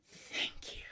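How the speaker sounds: barely-there whispered thanks; whispered reply. Retold or imitated. Student thanking the professor mid-lecture, whispered. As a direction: say it in a tiny whisper.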